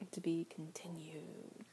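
slow unvoiced whisper. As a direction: whisper it slowly, teasing. Closing tease.

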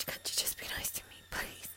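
breathy pleading whisper; whispering plea. Acted. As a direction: hush it to a begging whisper.

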